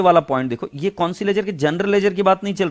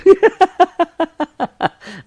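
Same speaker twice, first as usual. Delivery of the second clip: long high-pitched burst of laughter; real uncontrolled laughing fit; hearty laugh; 12 semitones higher, 6 dB louder than usual. Real, not acted. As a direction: crack up helplessly, loud and high. Cracks up over student's compatibility test.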